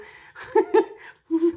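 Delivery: high bright laugh; burst of laughter. Real, not acted. Telling viewers about crying before surgery, a high laugh. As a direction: laugh out loud.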